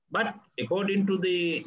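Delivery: ordinary talk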